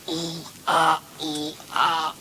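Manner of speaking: high, restrained moans; rhythmic little oohs and aahs; mock moaning. Same moans heard again, softer.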